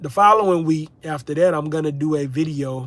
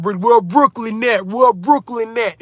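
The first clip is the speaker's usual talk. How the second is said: put-on higher, drawn-out New York accent calling out; 6 semitones higher, 4 dB louder than usual. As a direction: play it like a Brooklyn guy shouting out his borough in a New York accent. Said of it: "I can talk with a little New York accent".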